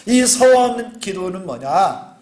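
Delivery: ordinary talk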